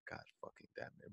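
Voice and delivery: barely voiced, muttered curse; under-breath curse. Cursing the sandstorm under his breath to himself, barely voiced.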